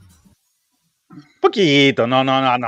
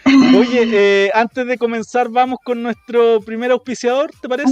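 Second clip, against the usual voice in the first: slow, higher announcer tone; 7 semitones higher, slower than usual. Acted. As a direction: present the sponsor like a host. Announces the first sponsor. (voz de locutor)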